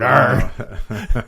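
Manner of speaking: growled pirate arr; a quick pirate arr. Pretend. Talk like a pirate.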